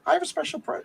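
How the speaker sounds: high, buttering-up villain voice; talking nice. Acted. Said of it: "he's talking nice to the Molecule Man".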